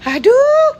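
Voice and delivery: loud, high-pitched "aduh" groan; exasperated wail. Real, not acted. Woman fed up with putting on lashes, exasperated wail.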